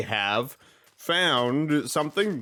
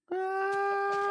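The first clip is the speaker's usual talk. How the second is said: startled yelp; 6 dB quieter than usual. Real, not acted. Skeleton attacks.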